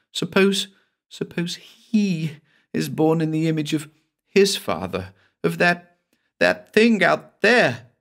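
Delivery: high woman's voice, slowing in dread at the end; horrified Cassie. Acted. Cassie tells her lover of the monster's child, high dread. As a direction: let the horror rise as she imagines it.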